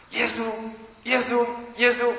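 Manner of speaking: drawn-out high calling of the name, pleading. Retold or imitated. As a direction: call 耶稣 slowly and high, like crying out for help. Addict calling on Jesus' name. (缓慢高声呼喊耶稣的名字)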